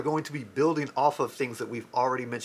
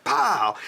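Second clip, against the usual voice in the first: high, loud punch noise; punch sound effect; 5 semitones higher, 5 dB louder than usual. Acted. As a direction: smack the sound out. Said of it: "so he was like".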